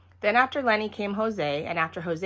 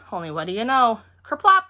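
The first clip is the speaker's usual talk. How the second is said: ordinary delivery, nothing marked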